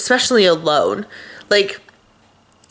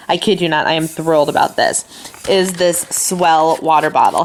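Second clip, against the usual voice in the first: ordinary talk, nothing marked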